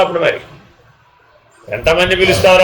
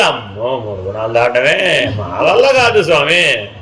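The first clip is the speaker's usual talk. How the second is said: helpless disciple; 6 semitones lower, slower than usual. Retold or imitated. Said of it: "అడగంగానే వీళ్ళు ఏం చేసేవాడు?".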